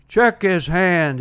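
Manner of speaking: slow, loud call of check his hand; retold yelling. Manager yelling at the umpire from third base, slow and loud. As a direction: bark it across the field like a riled manager. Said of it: "And he's yelling".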